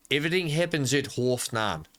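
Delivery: attempted South African accent on a practice phrase. Shown as a demonstration. Player practising for viewers, attempted South African accent. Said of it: "in South Africa, they might say it".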